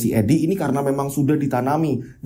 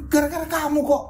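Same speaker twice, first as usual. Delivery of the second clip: sharp high blaming jab; blaming retort; 9 semitones higher than usual. Acted. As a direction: throw the blame right back at him. Father blames son in quarrel. (menyalahkan dengan nada tinggi)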